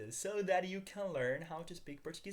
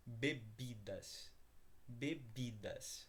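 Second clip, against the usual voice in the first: very slow, careful model of "bebidas"; slow model word; 3 semitones lower, 6 dB quieter, much slower than usual. Shown as a demonstration. Teacher modelling vocabulary for students, very slow. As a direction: draw "bebidas" out slowly, every syllable clear, for repetition. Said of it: "Repeat after me".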